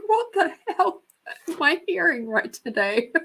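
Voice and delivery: high, giggly mock bewilderment; laughing disbelief. Storyteller reacting to what she heard, laughing disbelief. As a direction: squeal it through laughter, settling by the end.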